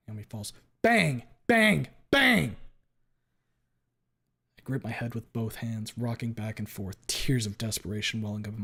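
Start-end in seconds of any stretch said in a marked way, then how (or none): 0.8-2.6 each bang louder and higher; rising gunshot shouts. Acted. Narrator reliving the shots, building panic. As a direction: build the bangs to a panicked peak.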